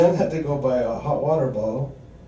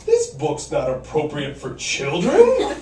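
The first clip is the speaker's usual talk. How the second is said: prim mom imitation; 4 dB louder, slower than usual. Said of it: "my mother says".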